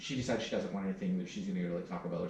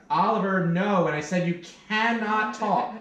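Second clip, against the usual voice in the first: stern scolding; 11 semitones higher, 10 dB louder, slower than usual. Real, not acted. Mother scolding her child mid-recording.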